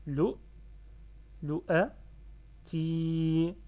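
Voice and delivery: LOU, LOU A, TI in loud separate pieces, ti held; syllable by syllable. Shown as a demonstration. Teacher separates syllables for learners, loud pieces and long ti. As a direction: sound out LOU, LOU A, then a long TI. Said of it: "en coupant syllabe".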